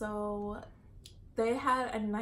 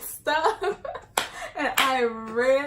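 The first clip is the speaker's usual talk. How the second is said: laughing through the words; giggling it out; 4 semitones higher, 12 dB louder, slower than usual. Real, not acted. Laughing while telling.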